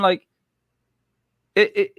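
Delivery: ordinary talk, nothing marked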